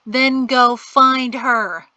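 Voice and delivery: slow, loud brush-off; dismissive. A narrator's reading. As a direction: push her away with the words. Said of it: "pushing her away".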